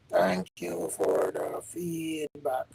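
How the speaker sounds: half-speed quiet repeat of the thanks; slow soft thanks. Real, not acted.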